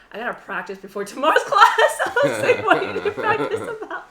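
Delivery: loud, very high, laughing through words; helpless laughter. Real, not acted. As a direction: crack up while retelling the exchange. Laughing over the friend's practice vow.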